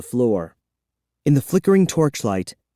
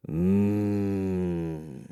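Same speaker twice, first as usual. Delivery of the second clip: much softer, lower hum; a thoughtful vampire murmur; 5 semitones lower, 9 dB quieter than usual. A narrator's reading. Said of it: "he murmured".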